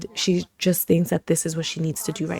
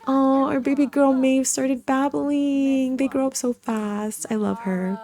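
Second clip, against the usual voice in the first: cooing; 7 semitones higher than usual. Real, not acted. Cooing over the baby.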